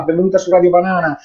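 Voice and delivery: usual speaking voice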